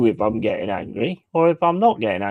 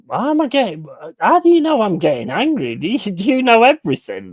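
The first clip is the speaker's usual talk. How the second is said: mock indignant retort; 6 semitones higher than usual. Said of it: "And I'm like".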